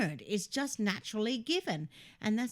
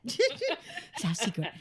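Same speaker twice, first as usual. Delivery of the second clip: laughing; 2 semitones higher, slower than usual. Real, not acted. Laughing while teasing on air.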